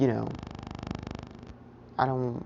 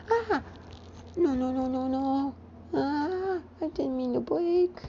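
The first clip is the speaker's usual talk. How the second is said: put-on very high panicked baby voice; scared baby; 13 semitones higher than usual. Toddler Midoriya after breaking the statue, panicked baby squeals. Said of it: "adorable baby scare noises".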